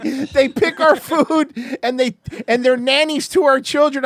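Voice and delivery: high, slow, put-on condescending voice; mocking patronizing voice. Repeating the hurricane-time remark in a hammed-up patronizing voice. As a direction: ham up the patronizing tone.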